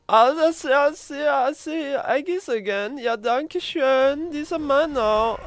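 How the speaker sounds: high mock German accent, slow on "Ja, danke schön"; the squeaky German cook greedily claims the eggs; hammed-up high German accent; German guy voice. Returns to German character voice.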